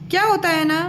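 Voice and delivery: ordinary talk, nothing marked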